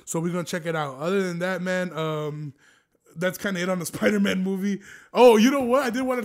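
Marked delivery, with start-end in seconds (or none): 5.1-6.2 loud, higher oh of sudden recall; sudden remembering. Real, not acted. Telling viewers a new topic, suddenly surprised. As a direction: blurt it out as the idea hits.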